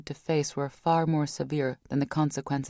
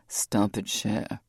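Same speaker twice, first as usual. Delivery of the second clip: lower, gently halting her; Rock, low and firm; 6 semitones lower, slower than usual. A narrator's reading.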